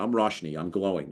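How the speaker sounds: ordinary talk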